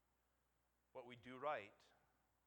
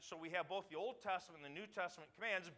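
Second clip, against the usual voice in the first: normal talking voice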